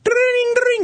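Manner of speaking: very high ringing phone noise; ringtone imitation. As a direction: chirp it like an old mobile ringing.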